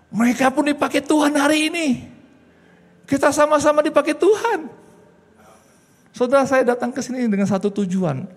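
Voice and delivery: high, loud preacher's cry; preaching at full force. Acted. Preaching climax. (khotbah berapi-api dengan suara keras dan tinggi)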